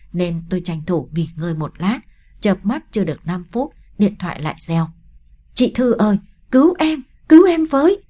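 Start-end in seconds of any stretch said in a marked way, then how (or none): 5.5-8.0 high, loud desperate cry; crying plea for help. Acted. Mi on the phone to Thư, crying out in panic. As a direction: scream to her sister to be saved. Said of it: "Tiếng con mì khóc lóc".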